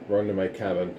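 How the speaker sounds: usual speaking voice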